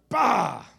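loud bleating bah like a sheep; sheepish bah. Retold or imitated. Pastor barking at his growling puppy, a loud sheep-like bah. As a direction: bark a bah that comes out like a sheep. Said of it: "It's not very masculine. Sound like a sheep".

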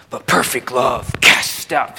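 low, weighty, over-pious quote; mock spiritual. Preacher quoting a favorite verse to the congregation, mock spiritual. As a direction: intone it with exaggerated spiritual gravity. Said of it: "Sounds very spiritual".

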